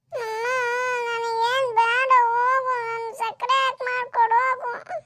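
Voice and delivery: put-on high, drawn-out whiny child voice; sulky child tantrum. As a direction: refuse like a stubborn small kid. Child's tantrum over the drink. (ಮಗುವಿನ ಹಠದ ರಾಗ)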